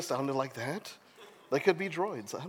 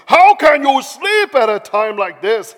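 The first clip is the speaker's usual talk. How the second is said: loud, high captain's yell; hammed-up bellow of disbelief; angry shout; 10 semitones higher, 16 dB louder than usual. A narrator's reading. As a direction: shout him awake in outrage. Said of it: "he shouted".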